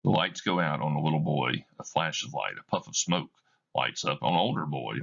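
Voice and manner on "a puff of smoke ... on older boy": usual speaking voice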